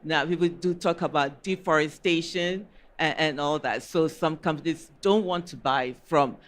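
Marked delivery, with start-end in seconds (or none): none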